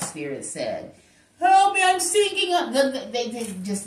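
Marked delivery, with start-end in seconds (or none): 1.4-2.7 higher and louder panicked plea; hammed-up drowning call; mock cry for help. The ex sinking in the water, in a mock cry for help.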